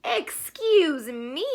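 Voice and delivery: startled rabbit yells to be let go; shouting rabbit. Acted. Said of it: "shouted the rabbit".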